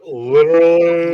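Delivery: loud, slow, stretched word; mock catchphrase voice for Literally. Shown as a demonstration. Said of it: "you gotta do the literally voice".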